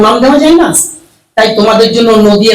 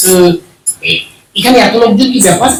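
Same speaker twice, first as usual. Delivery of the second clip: ordinary delivery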